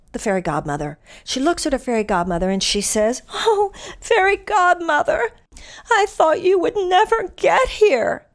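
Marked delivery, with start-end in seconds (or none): 3.3-8.3 put-on high, slow, breathless girlish Cinderella voice. Cinderella greeting her fairy godmother, high and slow.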